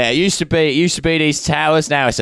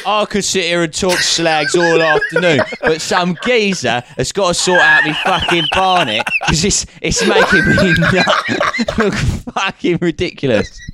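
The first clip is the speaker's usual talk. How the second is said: laughing mock East End lad; a hint of a London geezer; Cockney geezer; 3 semitones higher than usual.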